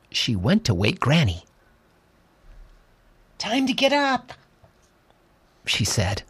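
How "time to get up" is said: put-on high, light girl's voice; young girl voice.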